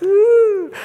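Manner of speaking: high, loud mocking ooh; schoolyard ooh. Preacher mocks schoolkids teasing about love, with a loud high ooh. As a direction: tease like a kid at primary school.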